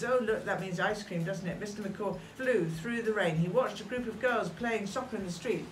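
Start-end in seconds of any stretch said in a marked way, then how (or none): none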